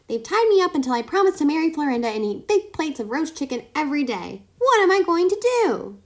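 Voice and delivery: high, fast, pleading squeak; pleading guinea pig. A narrator's reading. Kai pleads with the fox.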